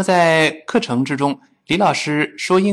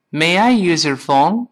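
slow and louder, every word clear; slow, careful teacher read; 4 semitones higher, 4 dB louder than usual. Shown as a demonstration. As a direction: read it out slowly for learners. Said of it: "我们慢慢读是这么来说的".

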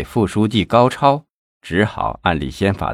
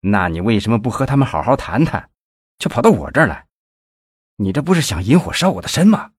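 angry reproach; 2 semitones higher, faster than usual. Acted. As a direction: blame him, barely holding back. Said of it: "生气地责问费庆奇".

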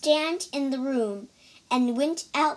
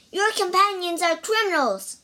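fast, higher accusing shout; shouted accusation; 7 semitones higher, 4 dB louder, faster than usual. A narrator's reading. Priest accusing the monk's companions, fast, high shout. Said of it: "shouted one priest".

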